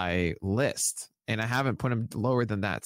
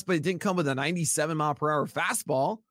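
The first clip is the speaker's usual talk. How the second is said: ordinary delivery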